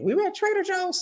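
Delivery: sudden high-pitched start; the place name pops up high and soft; high squeak. Real, not acted. False start, then restarted calmly.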